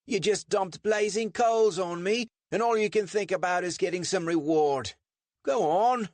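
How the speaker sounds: very high-pitched mock-English scolding; indignant English accent. A narrator's reading. Richard scolding the man who dropped coals, indignant English accent. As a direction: tell him off in a put-on English accent.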